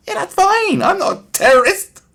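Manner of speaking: high, chirpy, sing-song innocence; mock gullible citizen. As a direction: play a gullible citizen happily agreeing.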